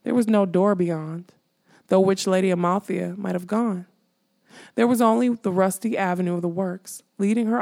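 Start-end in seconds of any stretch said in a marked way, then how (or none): none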